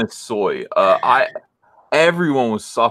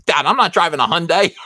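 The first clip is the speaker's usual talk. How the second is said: put-on higher-pitched spoiled kid complaining; put-on bratty indignation; whiny refusal; 7 semitones higher than usual. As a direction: protest to Dad in mock outrage. Acted spoiled child refusing a car.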